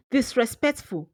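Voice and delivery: ordinary delivery, nothing marked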